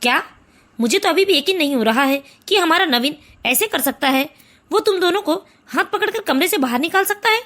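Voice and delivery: a father who can't believe his son did it; father's voice. Acted.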